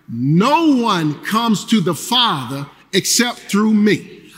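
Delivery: rousing, slow congregational call; call and response. Acted. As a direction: lead the room through the verse, slowly. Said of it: "repeat this with me".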